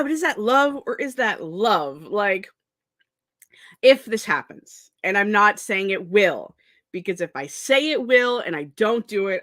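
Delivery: ordinary talk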